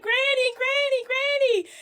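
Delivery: put-on high child voice calling Granny; little girl runs to grandma, high and calling. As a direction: squeal it like an excited little girl. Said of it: "The little girl ran down".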